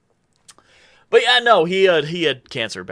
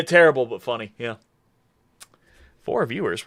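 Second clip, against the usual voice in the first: ordinary delivery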